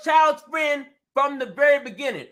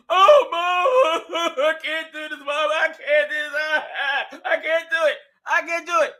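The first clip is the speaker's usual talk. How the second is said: put-on whining teen. Teenager whining, mocked by parent.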